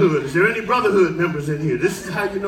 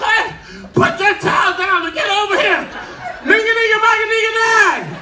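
high, loud, laughing panic; frantic yelling; 12 semitones higher, 4 dB louder than usual. Retold or imitated. Comedian yelling at a ship worker to help him, frantic and high. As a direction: holler at the guy, half-laughing.

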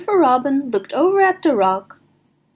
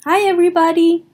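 cheery greeting; 7 semitones higher, 4 dB louder, much faster than usual. Acted.

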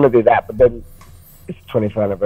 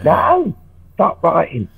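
bandmates telling him off; 9 semitones higher, much slower than usual. Retold or imitated. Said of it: "and they're like".

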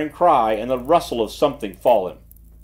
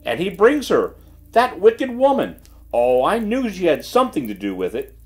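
impulsive outburst; 6 semitones higher than usual. A narrator's reading. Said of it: "burst forth Missus Brinkworth impulsively".

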